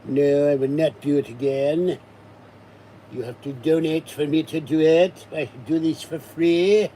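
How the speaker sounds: loud, hammed-up French accent; Clouseau accent. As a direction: play the pompous inspector refusing. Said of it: "Peter Sellers as Inspector Clouseau".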